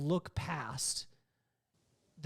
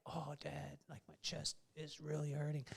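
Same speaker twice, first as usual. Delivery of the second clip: dad imitating son's faked sick whimper, much softer; mock sick kid; 3 semitones lower, 12 dB quieter than usual. Son fakes illness to dodge the game, murmured softly. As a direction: play the ten-year-old faking a sore chest, barely above a murmur.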